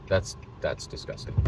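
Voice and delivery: soft unvoiced mock disgust; hushed, playful revulsion; whispered disgust. Real, not acted. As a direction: whisper it like a scandal.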